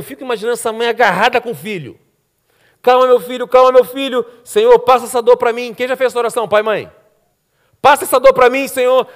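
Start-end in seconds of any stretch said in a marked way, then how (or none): 2.8-5.7 raised, urgent motherly plea; higher-pitched, insistent soothing and praying; pleading mother. Acted. Voices mother praying over sick son. 7.8-9.1 loud, higher-pitched desperate prayer; acted urgent plea; pleading mother.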